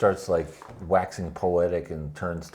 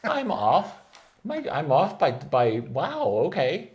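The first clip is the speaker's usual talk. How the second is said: higher, hammed-up amazed aristocrat; mock amazement; 6 semitones higher, slower than usual. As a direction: act dazzled by the news, pitched up. Trelane quoted, amazed.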